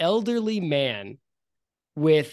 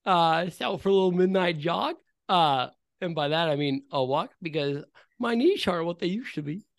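put-on old man voice; 4 semitones higher than usual. Old man Clarence keeps talking.

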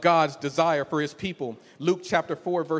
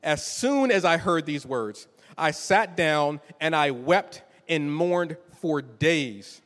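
normal talking voice

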